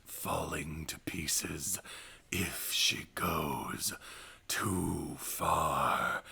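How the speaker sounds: whispered movie trailer narration. Pretend. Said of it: "My trailer voice".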